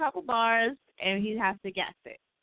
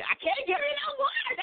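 squeaky high. Real, not acted. Playful high squeal to friend.

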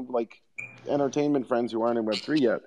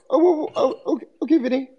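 much higher, nervous voice; squeaky high voice; 14 semitones higher than usual. Acted.

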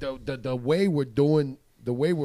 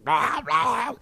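loud high gurgled "blah, blah"; gurgling blah; 11 semitones higher, 6 dB louder than usual. Retold or imitated. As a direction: blurt out a gagging blah. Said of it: "I started going".

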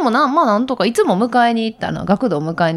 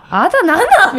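exasperated; 12 semitones higher, 6 dB louder than usual. Retold or imitated. Mother voicing annoyance at son.

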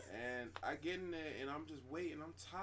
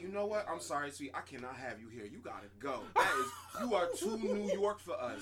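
retold lady; 8 dB louder than usual. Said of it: "the lady was just like".

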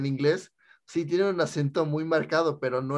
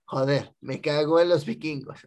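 laughing mock Spain accent. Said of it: "ese no es acento español".

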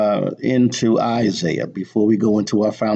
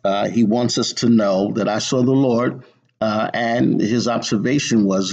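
normal talking voice

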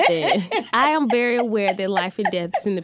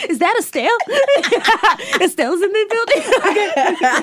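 laughing; 8 semitones higher, 5 dB louder than usual. Real, not acted. Laughing announcement.

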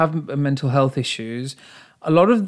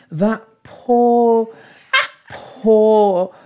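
mock pity; 8 semitones higher, 4 dB louder, much slower than usual.

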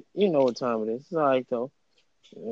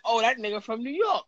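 mock excited recognition; 9 semitones higher than usual. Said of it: "they gonna be like".

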